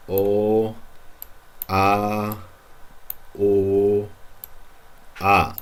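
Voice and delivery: each vowel held alone and clear; isolated vowels. Shown as a demonstration. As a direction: sound out the vowels one by one. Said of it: "let's focus on the vowels".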